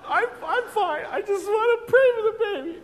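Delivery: father in tears tells the worried nurse he's fine; insisting I'm fine. Retold or imitated. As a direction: brush off the nurse, voice cracking high. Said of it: "And I said".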